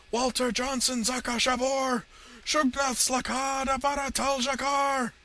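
high, slow, very loud chant-like cry; shouted invocation. A narrator's reading. Villain invokes his master as he falls, desperate shouted incantation. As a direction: bellow the spell, stretching every word.

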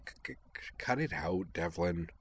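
soft, halting stammer on first sounds; a gentle nervous stammer; stammering girl. Acted. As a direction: stumble shyly over each start. Kate's stammering dialogue.

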